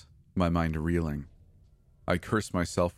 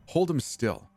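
Becca's voice pitched up, ordering; higher-voiced girl giving a command; 9 semitones higher than usual. A narrator's reading. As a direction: bark it as a sharp order. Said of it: "she commands".

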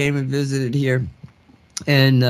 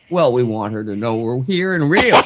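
laughing Sasquatch quote; 5 semitones lower than usual. Retold or imitated. Teller quoting Sasquatch to podcast host, laughing. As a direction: lower voice, half laughing through it.

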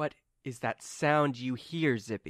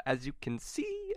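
usual speaking voice

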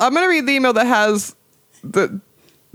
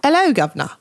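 higher, posher British greeting; mock-posh British accent; 5 semitones higher, faster than usual. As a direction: attempt a posh British "'ello, guv'nor". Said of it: "try to be more posh about it".

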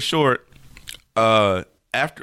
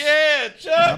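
joking shout. Real, not acted. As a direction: yell it out teasing.

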